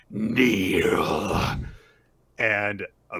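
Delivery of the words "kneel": a louder, put-on demon bark; a lightly voiced demon booming an order; demonic command. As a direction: command it like a looming shadow. Goat skull shadow commands.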